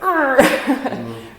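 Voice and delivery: loud, high mock-straining "rrr"; playful growl of stiffening up, breaking into a laugh; strained rigid growl. Shown as a demonstration.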